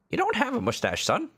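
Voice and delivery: high falsetto mom. Acted. Mom voiced in game.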